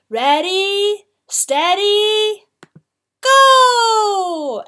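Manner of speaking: high, loud, building starter's call; shouted race start. A narrator's reading. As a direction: draw out each word, then cry the last. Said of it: "The Jade Emperor shouted".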